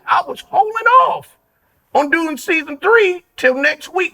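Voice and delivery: real excited shout. Real, not acted. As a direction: burst out with excitement.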